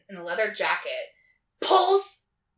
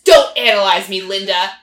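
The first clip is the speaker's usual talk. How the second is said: much louder, acted-out protest; mock angry outburst; 14 dB louder than usual. Husband objecting to his wife playing therapist, mock angry and loud. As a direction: burst out loud as the exasperated husband.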